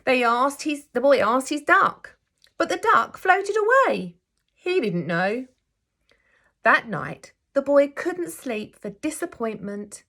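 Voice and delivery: gentle, expressive storybook reading; teacher reading a picture book aloud to her class; reading to children. A narrator's reading. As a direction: read it like story time for little ones.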